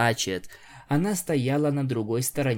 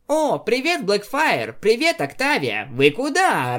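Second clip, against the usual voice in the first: high, bubbly pink pony voice; talking pony; 9 semitones higher, 4 dB louder than usual. Acted. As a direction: chirp a cheerful greeting, high. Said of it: "раздался голос розовой пони".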